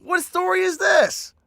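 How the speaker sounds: high, loud, drawn-out disbelief; real outburst of baffled amusement; incredulous shout. Real, not acted. Astonished at absurd backstory.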